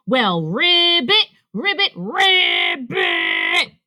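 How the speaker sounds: slow, loud, drawn-out mock ribbits; mocking croak. Rabbit croaking back at Ribbit, loudly mocking. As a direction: exaggerate the frog's croak mockingly.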